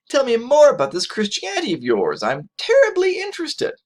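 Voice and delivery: high, exaggerated mock enthusiasm; hammed-up insincere eagerness; sarcastic fake interest. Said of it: "his enthusiasm is not genuine".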